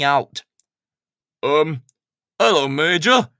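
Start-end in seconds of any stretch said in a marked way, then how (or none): 1.4-3.3 sheepish workman, a bit louder; awkward hello from a clumsy handyman; Ted's voice. Acted. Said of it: "said Ted".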